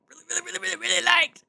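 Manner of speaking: high, fast squeal of delight; gushing. Real, not acted. Fan gushing about the soundtrack, high excited squeal. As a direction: squeal the really's up high and quick.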